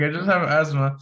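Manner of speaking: laughing through the excuse. Retold or imitated. Repeats excuse while laughing.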